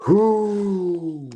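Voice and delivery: ordinary talk, nothing marked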